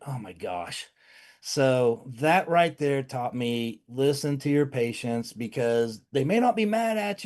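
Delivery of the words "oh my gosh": dismayed murmur. Retold or imitated. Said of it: "I'm like".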